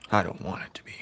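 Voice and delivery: talking while chewing gum. Real, not acted. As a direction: chew gum while speaking. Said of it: "I'm chewing gum".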